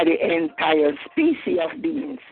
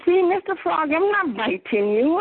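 higher, sweet-talking scorpion voice; sly scorpion; 10 semitones higher than usual. Acted. Scorpion on the frog's back, sweetly reassuring him. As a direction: coax the frog, all innocence.

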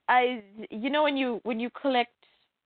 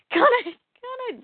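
put-on high pleading child voice; whiny kid begging; 12 semitones higher, much faster than usual. Kid begging for a different Happy Meal toy, high and whiny. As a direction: beg like a kid at the counter.

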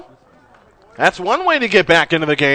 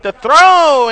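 excited; 9 semitones higher, 10 dB louder than usual. Real, not acted. Close play at first base.

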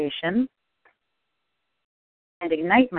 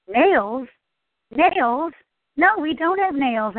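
high, baffled repeat of nails; incredulous bartender; 7 semitones higher, slower than usual. Acted. Caller telling a joke, bartender puzzled and pitched high. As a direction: sound puzzled by the odd question. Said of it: "answers the bartender".

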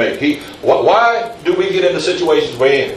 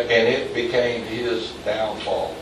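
drops to a softer, slower voice on downfall; quiet, slowed downfall; 4 semitones lower, 8 dB quieter, slower than usual. Real, not acted. Preacher to congregation, slow and hushed on downfall. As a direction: let downfall sink in quietly. Preacher lets word sink.

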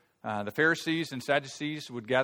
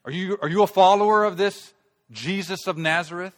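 loud, high, mocking retort; mocking Pharisees; 7 semitones higher, 10 dB louder than usual. Pharisees mocking Nicodemus, loud and contemptuous. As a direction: jeer at him as a Galilean. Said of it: "They replied".

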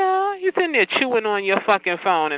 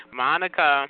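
ordinary delivery

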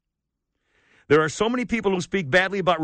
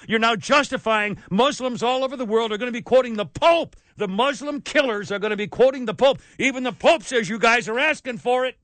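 furious rant; 6 semitones higher than usual. Real, not acted.

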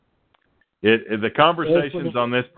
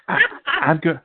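Chelsea impression; 7 semitones higher than usual. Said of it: "Chelsea has something to say".

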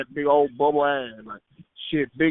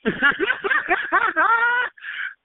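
high laugh; 3 dB louder than usual. Real, not acted. Mocking laughter at the insult.

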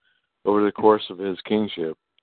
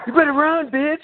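high-pitched, loud mock yell; yelling at screen; 4 dB louder than usual. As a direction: yell it like a viewer heckling the screen. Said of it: "people would be yelling at the screen".

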